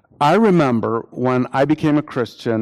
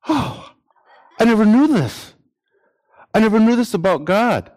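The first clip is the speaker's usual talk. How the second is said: high, awestruck exclamation, faster at the end; amazed wonder; 7 semitones higher, faster than usual. Pretend. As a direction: marvel at a new discovery about God. Imagined believer amazed in heaven.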